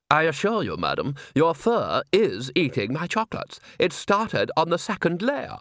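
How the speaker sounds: stuffy gent, affronted; pompous insistence. Acted. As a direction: insist with dignity. Gentleman insists.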